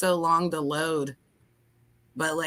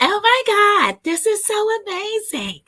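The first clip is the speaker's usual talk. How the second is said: much higher voice through the nose; nasal voice; 12 semitones higher, 4 dB louder than usual. Shown as a demonstration. Guest retrying the smart voice, high nasal. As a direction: pitch it way up, nasally. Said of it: "talk through your nose and not whine".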